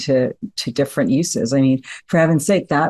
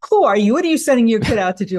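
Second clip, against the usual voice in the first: higher, fast indignant grilling; mock accusation; 8 semitones higher, faster than usual. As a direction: rattle off the accusations quickly. Neighbor accosting father.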